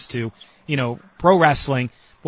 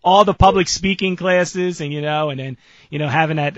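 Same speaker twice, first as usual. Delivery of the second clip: usual speaking voice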